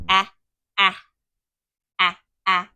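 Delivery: short a sounds, a a a; teacher modeling the short a; letter sound a. Shown as a demonstration. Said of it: "What letter says a".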